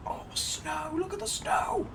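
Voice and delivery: high, soft delighted squeal. Real, not acted. Delighted at snowy spot.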